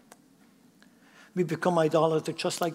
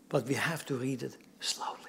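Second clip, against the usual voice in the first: much softer, lower, nearly whispered; hushed caution; 5 semitones lower, 9 dB quieter than usual. Real, not acted. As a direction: drop right down to caution them. Qualifies the instruction by hushing it.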